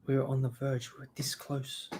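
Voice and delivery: a hint of the actor's tense delivery; quoting Mendelsohn. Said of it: "Quoting an Australian, uh, actor, Mendelsohn".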